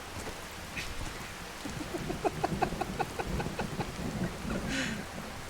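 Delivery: breathless silent giggling; wheezing laugh. Real, not acted.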